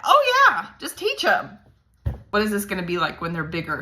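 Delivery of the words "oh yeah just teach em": mock past conviction. Acting out her own past thought.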